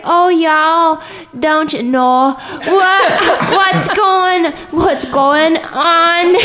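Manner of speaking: hammed-up Southern drawl, slowed and stretched; horrible Southern accent. Storyteller mimics their awful audition reading, hammed-up Southern accent. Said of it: "Do you want me to use a Southern accent?".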